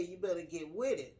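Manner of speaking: ordinary talk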